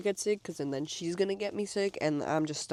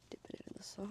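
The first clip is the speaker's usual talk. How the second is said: soft whisper to herself; 16 dB quieter, faster than usual. Real, not acted. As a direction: breathe it out under your breath. Dipping fries, hushed aside.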